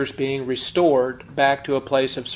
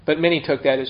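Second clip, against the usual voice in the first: ordinary delivery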